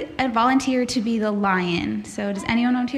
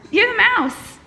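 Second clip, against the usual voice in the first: ordinary talk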